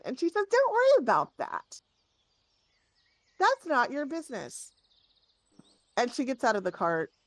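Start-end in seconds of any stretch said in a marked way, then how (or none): 0.5-4.7 high, indignant brush-off; pitched up, cross and dismissive; sharp rebuff. Acted. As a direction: shut down a nosy question. Angry noblewoman rebuffs a guard.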